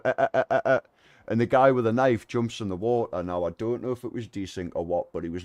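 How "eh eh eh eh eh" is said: stunned eh eh eh, louder and higher; imitating the gang gaping speechless; mock stammer. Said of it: "all stood there going".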